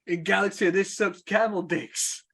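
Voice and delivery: high-pitched mock outburst; imitated rant. Acting out a film rant.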